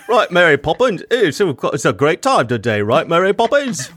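hammed-up bad Cockney accent. American guest mocking his own British attempts, bad Cockney. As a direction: do a badly faked chirpy Cockney.

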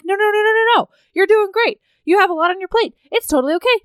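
high, loud rushed comfort; high-pitched frantic reassurance. Retold or imitated. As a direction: pile on the no's to calm him down. Both reassure Tom frantically.

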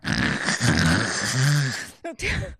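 big honking snore sound; hammed-up snore; loud fake snore. Player imitates loud snore.

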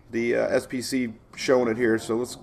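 normal talking voice

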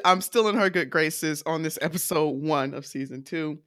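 ordinary talk, nothing marked

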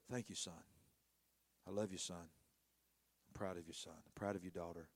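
soft, low, tender voice of a proud father; tender blessing. Retold or imitated. Pastor voicing his texts to spiritual children, softly tender.

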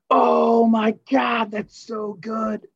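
gushing high-pitched imitation, stretched out slowly; hammed-up crowd swooning over a joke; mock amazed reaction. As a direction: exaggerate the awe, high and drawn out. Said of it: "they react like they've never heard that".

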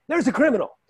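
high, exclaimed accusation; mock alarm. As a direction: call it out like spotting a suspect. Imitates cops spotting runner.

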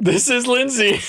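high-pitched, laughing through the intro. Real, not acted. Co-host botches his intro after a name swap, laughing. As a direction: crack up while announcing the name.

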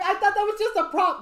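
high, loud, laughing; high laughing mock voice. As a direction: squeal it through laughter. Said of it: "He's like".

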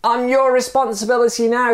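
very high insistent call; high-pitched acted shout. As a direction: call it up from below the bridge. Dangling stranger calls up to man.

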